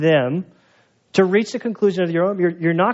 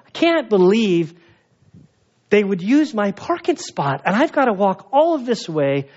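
ticked-off grumbling; 4 semitones higher than usual. Retold or imitated. Man walking from his lost parking spot, ticked off. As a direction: retell being put out, dragging the words. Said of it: "I'm, like, ticked off".

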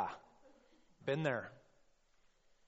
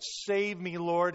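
higher, louder cry for help; raised shout; 5 semitones higher, 7 dB louder, slower than usual. A narrator's reading. Preacher as sinking Peter calling to Jesus, raised shout. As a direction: call out urgently, partway to a yell. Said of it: "he shouted".

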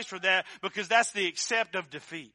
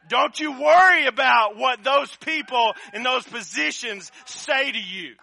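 rising preached shout; 4 semitones higher, 9 dB louder than usual. Retold or imitated. Pastor's text to his son, preached.

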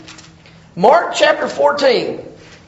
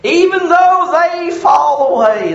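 boastful Peter; 3 semitones higher, slower than usual. Retold or imitated. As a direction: declare it big like brash Peter. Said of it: "Peter said to him".

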